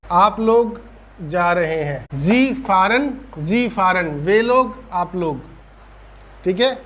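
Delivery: ordinary delivery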